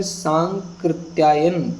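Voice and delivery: Sankrityayan drawn out slowly, syllable by syllable; slow dictation. Shown as a demonstration. Teacher spelling out Sankrityayan for students, slowly. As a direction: break Sankrityayan into its parts, slowly. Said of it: "देखिए सांकृत्यायन में देखेंगे".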